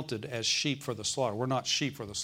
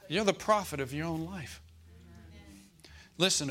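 ordinary talk

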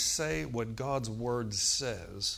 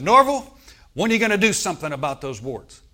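loud commanding call from above; booming call; 6 semitones higher, 11 dB louder than usual. Retold or imitated. The Lord confronting Norval in his prayer closet, booming. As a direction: call out his name with full force.

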